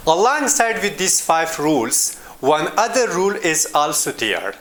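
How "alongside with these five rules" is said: usual speaking voice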